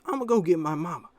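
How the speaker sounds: high, loud put-on boy voice; imitated kid. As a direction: mimic a kid running for mama. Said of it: "he goes".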